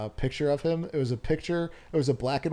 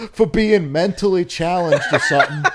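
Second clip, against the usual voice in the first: reading while cracking up; can't keep a straight face; loud read breaking into laughter; laughing through; 4 semitones higher, 8 dB louder than usual. Real, not acted. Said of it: "breaking into laughter mid-read".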